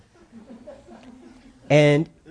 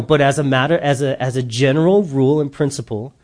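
usual speaking voice

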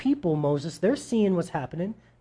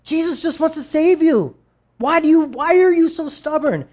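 high, loud, exasperated plea to a loved one; frustrated pleading; 10 semitones higher, 4 dB louder than usual. Retold or imitated. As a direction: push hard, frustrated they won't listen. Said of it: "and we're just like".

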